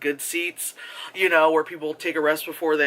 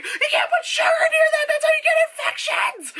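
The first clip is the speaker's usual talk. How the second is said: screamed warning; 4 dB louder than usual. Retold or imitated. Vlogger yelling at the screen about sugar, shrieking. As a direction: scream it, cracking up. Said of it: "I just start screaming".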